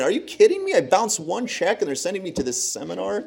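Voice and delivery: first line jumps very high in mock disbelief; retold disbelief. Young man reading the fine letter, mock disbelief. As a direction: play your own shock at the letter.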